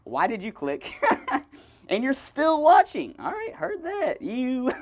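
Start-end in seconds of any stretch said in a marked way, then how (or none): 0.9-1.4 high laugh. Real, not acted. Laughing at own joke. 1.9-4.7 mock teasing. Mock roasting the viewer.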